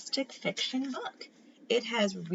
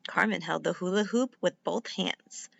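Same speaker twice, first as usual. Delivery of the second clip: usual speaking voice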